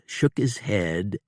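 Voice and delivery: usual speaking voice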